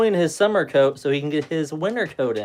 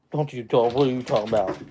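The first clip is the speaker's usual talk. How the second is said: playful dog talk, a little louder; teasing the dog; 3 dB louder than usual. Real, not acted. Owner teasing his husky for talking back, playful. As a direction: tease the dog as it grumbles.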